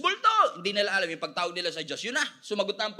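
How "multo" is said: high, loud yell; hammed-up cry of terror; panicked call. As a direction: call out in fright to be saved. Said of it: "Tawag sila ng tawag sa Diyos".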